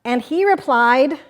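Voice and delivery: lively picture-book reading; reading to children. Acted. Host reading Pooh Bum aloud on the radio, playful storytelling. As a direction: read it like story time for kids.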